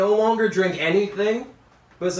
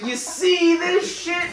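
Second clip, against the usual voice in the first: excited show-off; 11 semitones higher, slower than usual. Retold or imitated. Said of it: "I'm like".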